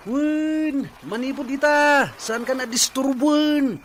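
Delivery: loud, high, slow angry declaration; furious. Acted. Husband telling wife he's leaving, furious and loud. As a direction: spit every word out in rage.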